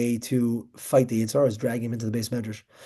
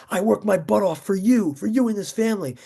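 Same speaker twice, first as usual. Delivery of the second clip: mock-exasperated outburst, pitched up high; indignant retort; 8 semitones higher than usual. Overworked father arguing with his kid, indignant retort. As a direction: retell an aggrieved protest in a raised voice.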